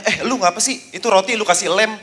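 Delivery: high, incredulous "what are you doing"; startled question. Acted. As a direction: raise the pitch in disbelief. Asking the glue-sniffing kid. (kaget, nada tinggi tak percaya)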